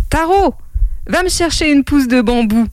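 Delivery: lightly voiced mother sending a child off. Mother asking her son for a bamboo shoot, lightly voiced motherly call. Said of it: "dit la mère".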